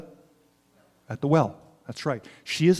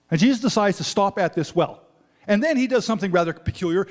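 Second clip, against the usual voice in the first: normal talking voice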